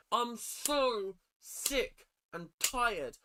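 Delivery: slow, high-pitched exasperation; crashing out. Acted. Commentator about to crash out, theatrical exasperation. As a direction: draw out each word, fed up. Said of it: "gonna have a crash out".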